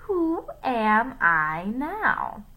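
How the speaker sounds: loud, very slow teasing question; villain mimicking a child. A narrator's reading. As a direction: draw it out in a put-on voice.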